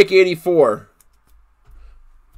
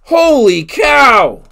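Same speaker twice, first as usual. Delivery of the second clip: amazed; 4 semitones higher, 3 dB louder, much slower than usual. Real, not acted. Reacting to a big hit.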